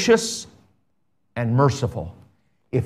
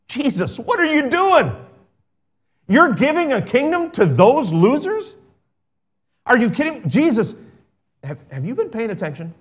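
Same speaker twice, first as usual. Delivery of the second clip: high-pitched mock disbelief at Jesus; incredulous objection; 9 semitones higher than usual. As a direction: act out a shocked onlooker, voice jumping up.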